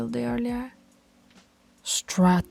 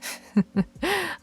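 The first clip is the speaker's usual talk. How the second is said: light, higher chuckle; amused chuckle; 9 semitones higher than usual. Acted. Alpha hearing pup's ambition, amused chuckle. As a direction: chuckle at the bold idea.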